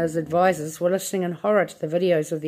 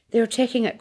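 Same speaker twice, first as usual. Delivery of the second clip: cried out; 5 semitones higher, faster than usual. A narrator's reading. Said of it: "cried Charlie".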